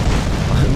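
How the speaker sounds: short loud burst of laughter; amused laugh. Real, not acted. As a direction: laugh at the silly death. Laughs at character flying away.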